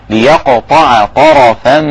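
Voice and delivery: ليقطع with a bouncing ق and a heavy ط in طرفا; qalqala recitation. Shown as a demonstration. Teacher recites ليقطع طرفا with qalqala. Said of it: "القوة والسرعة لا بد أن تظهر".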